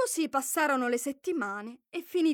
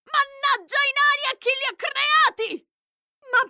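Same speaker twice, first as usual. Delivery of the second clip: much higher and louder, spitting venom; furious outburst; 11 semitones higher, 5 dB louder than usual. Acted. Queen curses the dwarves in fury, shrill and loud. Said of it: "Tutta furibonda e sputando veleno, lei disse forte".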